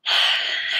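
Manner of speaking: short breathy sigh. Real, not acted. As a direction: let out a quick unvoiced sigh. Sighs after greeting viewers.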